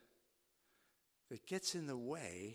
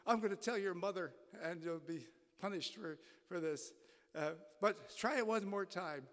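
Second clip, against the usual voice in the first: upset father; 8 semitones higher, 4 dB louder than usual. Retold or imitated.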